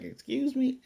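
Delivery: mock outrage at the proposal; indignant excuse me. Eris refusing a marriage proposal.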